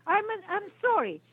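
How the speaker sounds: high, shrill, exasperated yell; furious customer yelling down the phone. Real, not acted. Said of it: "You better stop yelling at me".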